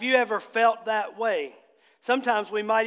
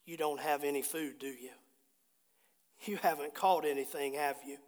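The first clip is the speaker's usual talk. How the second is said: low, hushed, gentle question; quiet, understated asking; softly voiced Jesus; 8 semitones lower, 11 dB quieter than usual. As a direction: say it low and soft, almost to yourself. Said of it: "he was really saying this".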